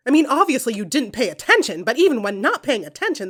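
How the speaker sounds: mock-exasperated rant. A narrator's reading. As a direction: scold yourself in disbelief. Exasperated at own inattention.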